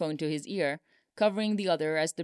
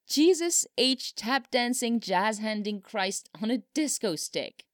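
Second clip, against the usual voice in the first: high, drawn-out astonished oath; amazed outburst; 6 semitones higher than usual. A narrator's reading. Still venting amazement on the phone.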